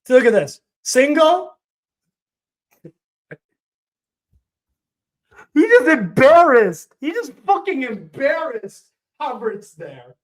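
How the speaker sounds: real excited yell; excited outburst. Real, not acted. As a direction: shout in disbelief at the play. Outburst at a replay.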